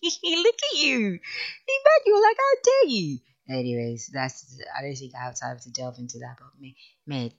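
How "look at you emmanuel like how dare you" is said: very high, loud playful scolding; mock outrage. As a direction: squeal a mock telling-off through a laugh. Teasing a correspondent with mock outrage.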